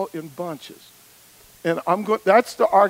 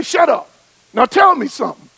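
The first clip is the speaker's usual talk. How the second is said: loud, higher bossy mother's snap; mama scolding; 4 semitones higher, 7 dB louder than usual. Retold or imitated. Mama hushing her chattering son, a loud mock scold. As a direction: play the exasperated mama cutting him off.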